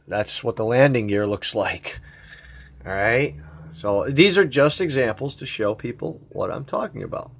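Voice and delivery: normal talking voice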